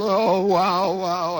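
retold groaning of pain; acted pained moan. Player being helped off the field, moaning in pain. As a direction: moan it as if hurting.

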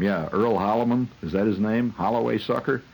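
ordinary delivery

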